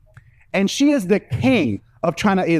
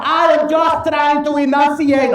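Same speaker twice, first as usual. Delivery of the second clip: very high, slow put-on mom voice; mom over-enunciating; 12 semitones higher, 4 dB louder, slower than usual. Mom doubling down before the cop, high careful enunciation. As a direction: spell out each word slowly, pitched way up. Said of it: "She doubled down".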